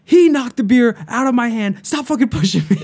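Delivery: retold yell through laughter; heated yell. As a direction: shout your side over the loud music. Fight on party bus.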